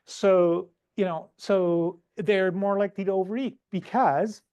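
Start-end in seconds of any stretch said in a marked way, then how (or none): none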